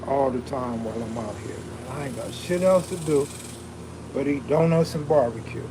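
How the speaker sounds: usual speaking voice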